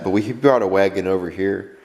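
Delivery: ordinary talk, nothing marked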